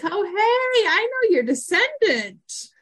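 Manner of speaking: high, drawn-out greeting with a smile; pleased smile. Retold or imitated. Ray greets someone whose family he knows, high and smiling. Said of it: "Ray kinda gets a little smile".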